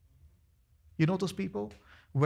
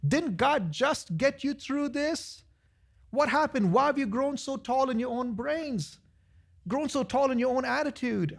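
exasperated pleading; 8 semitones higher than usual. Retold or imitated. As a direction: rein in someone getting too big. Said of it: "And I'm like".

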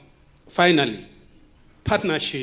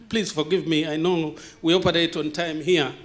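ordinary delivery

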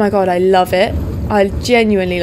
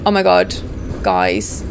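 usual speaking voice

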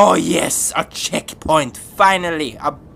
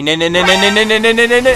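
rapid repeats climbing louder and higher; mounting panic; 6 semitones higher, 10 dB louder, faster than usual. Real, not acted. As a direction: build steadily toward a scream. Panicking as danger nears.

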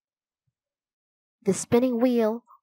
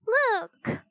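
high-pitched cry of alarm; startled cry; 13 semitones higher than usual. A narrator's reading. Annie spots a floating bone, startled cry. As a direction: cry out high, without extra volume. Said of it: "Annie cried".

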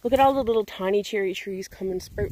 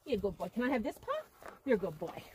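much softer, tender talk to the dog; hushed praise while asking for a paw; soft cooing; 12 dB quieter than usual. Real, not acted. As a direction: coax the dog gently.

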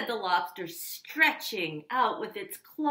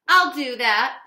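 loud high screech; loud mock screech from the grouchy ladybug; 6 semitones higher, 12 dB louder than usual. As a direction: snap it back. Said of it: "screeched the grouchy ladybug".

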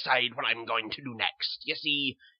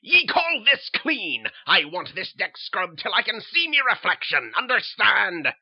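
loud, harsh pirate-captain roar; bellowing captain; 12 semitones higher, 15 dB louder than usual. Acted. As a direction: bark orders like a tyrant at sea. Mad captain berating sister.

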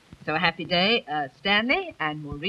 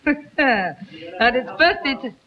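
en riant; 2 semitones higher, slower than usual. Real, not acted. Laughs after guests' joke.

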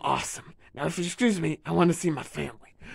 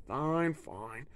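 ordinary talk, nothing marked

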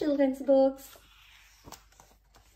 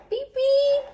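high, loud toot; imitated horn beep; 7 dB louder than usual. Storyteller signs off the tale, imitating a horn.

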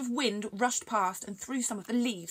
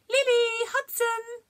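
loud high calling of names; shouted call; 8 dB louder, slower than usual. Acted. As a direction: call the dogs from afar. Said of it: "the Newman shouted".

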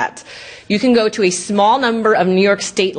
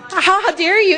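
mock offended indignation; 9 semitones higher than usual. Mocking offended tech support.

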